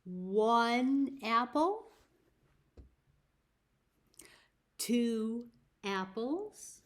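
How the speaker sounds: put-on slow, drawn-out counting for children; slow counting rhyme. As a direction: count each apple slowly, like a nursery rhyme.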